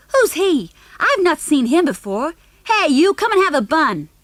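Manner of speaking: high, loud call to the dog; loud boy calling. Acted. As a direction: hail the dog like a brash kid.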